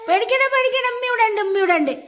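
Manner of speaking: high, quick reassuring murmur; calm the scared little one fast. Real, not acted. Calming frightened child.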